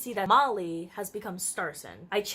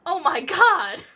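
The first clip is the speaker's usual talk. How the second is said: loud, higher mock-horror exclamation; shocked; 9 semitones higher, 11 dB louder, slower than usual. Real, not acted. Reacting to her own spooky story, shocked. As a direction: gasp it out in horror.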